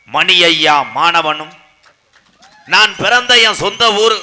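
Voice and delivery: loud, declaimed stage announcement; stage self-introduction. Acted. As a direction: announce yourself proudly to the whole crowd. Introduces himself to the audience.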